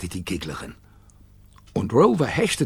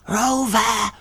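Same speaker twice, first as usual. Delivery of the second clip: high-pitched call like a dog whistle; high creature call; 9 semitones higher than usual. A narrator's reading. Gigglerin summoning the dog next door, high call. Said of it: "Er hörte die Gigglerin rufen".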